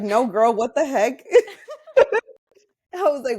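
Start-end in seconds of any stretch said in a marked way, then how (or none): 0.0-1.2 higher, mock-exasperated "no, girl" with a laugh; incredulous retort. North Korean friend teasing her naive dorm mate, mock exasperated. 1.3-2.3 high, loud laughing burst; burst of laughter. Real, not acted. Remembering her own naive reply, a loud high laugh.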